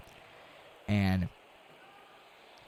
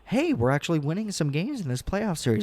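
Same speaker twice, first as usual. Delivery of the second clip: mock hopeful fan; 9 semitones higher, 3 dB louder than usual. Voicing naive hopeful fans.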